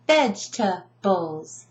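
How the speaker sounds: "Vegetables" slowly as vej, tuh, bulls; "Vegetables" chopped into three beats, no middle E; broken into syllables. Shown as a demonstration. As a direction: say "Vegetables" in three separated chunks. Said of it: "I break it down completely".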